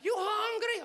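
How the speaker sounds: high-pitched mom voice, questioning; high mock-mother question; immigrant mother. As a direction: play the immigrant mother checking on her son. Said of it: "come home and tell my mom".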